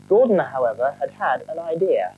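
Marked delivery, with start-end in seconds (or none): none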